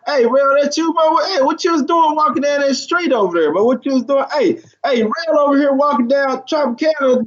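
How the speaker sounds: high, mocking friend calling out; teasing fan. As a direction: rib him like a nosy buddy on the street. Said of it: "somebody hit you up".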